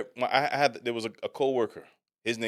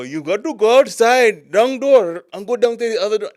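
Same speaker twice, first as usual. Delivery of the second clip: mock Sikh accent; 12 semitones higher, 13 dB louder than usual. Partner directing a Sikh delivery man, loud mock Indian accent. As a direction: shout it in a put-on Sikh man's accent. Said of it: "he would go".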